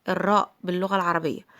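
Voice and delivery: normal talking voice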